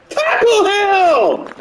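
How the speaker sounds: very high, loud, drawn-out yell; long frantic gamer scream; desperate shout. Real, not acted. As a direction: scream it at your defense, stretching it out. Yelling at players during game.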